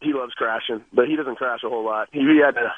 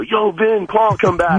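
higher-pitched mock calling out; mock radio yell; 9 semitones higher, slower than usual. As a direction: imitate calling drivers back over the radio. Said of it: "they're yelling over the radio".

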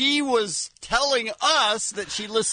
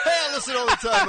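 higher, quick imitation of a cheerful guest; mock-chirpy and rushed, as the caller; imitating eager guest; 5 semitones higher, much faster than usual. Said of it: "She's like".

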